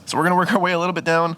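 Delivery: usual speaking voice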